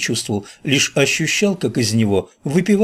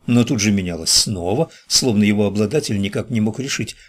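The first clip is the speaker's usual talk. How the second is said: ordinary delivery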